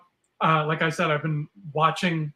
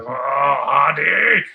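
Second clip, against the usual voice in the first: very slow, louder drawn-out villain voice; angry Doc Ock impression; 8 dB louder, much slower than usual. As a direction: growl the name like a mad cartoon villain. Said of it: "see him get mad and be like".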